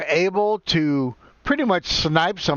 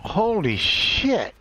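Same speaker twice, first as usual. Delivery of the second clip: slow, higher-pitched astonished curse; amazed swearing; 5 semitones higher, much slower than usual. Real, not acted. Gamer stunned by the action, amazed swearing. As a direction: draw the curse out in surprise.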